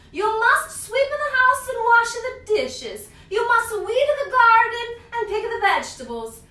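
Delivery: put-on high, loud old witch voice listing chores; Strega Nonna giving orders. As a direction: boss the helper around like a grandma witch, high and loud. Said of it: "said Strega Nonna".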